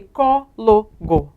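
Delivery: "cólogo" slow, in three beats co, lo, go; syllable by syllable. Shown as a demonstration. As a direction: break "cólogo" into evenly spaced syllables. Teacher splitting word into syllables.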